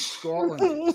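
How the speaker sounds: high-pitched mock-Scottish "Scotland"; bad Scottish accent. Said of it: "I can't really do a Scottish accent".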